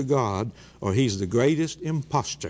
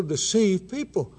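ordinary talk, nothing marked

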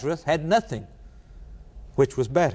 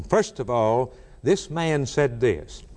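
ordinary delivery

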